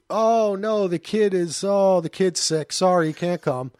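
hammed-up phony regret; fake apologetic excuse. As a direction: beg off with a put-on apology.

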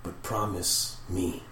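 soft, halting dying farewell; slowly fading voice. Acted. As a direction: speak as a spirit slipping away, with long pauses. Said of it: "that voice slowly start disappearing".

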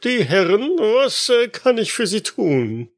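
high, eager doorman voice; old porter voice. Acted. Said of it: "ein etwas älterer Portier macht auf sich aufmerksam".

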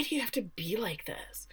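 soft whispered complaint; Nat, hushed. Retold or imitated. Nat answering Travis's taunt, hushed whisper. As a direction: whisper it, worn down and fed up. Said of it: "Nat's like".

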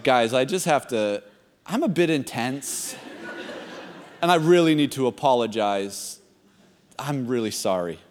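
low, soft, halting apology; sheepish. Retold or imitated. Embarrassed player apologizing to teammates at halftime, sheepish. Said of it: "sheepishly walk to the halftime circle".

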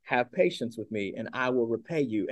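low, soft, understated pleading; retold plea. Fellow slave begs his creditor for patience, softly pleading. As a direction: plead quietly, lightly voicing the slave. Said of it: "began to plead with him".

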